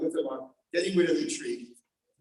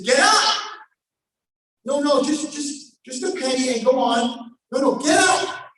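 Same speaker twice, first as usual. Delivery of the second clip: high, loud urgent order; commanding shout; 7 semitones higher, 6 dB louder, slower than usual. Acted. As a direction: call out to her, insisting. King commands girl to rise.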